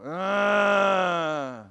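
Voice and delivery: long, loud groaning wail; imitated sustained cry of distress. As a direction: hold a loud, anguished moan. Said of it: "Here's the same guy go".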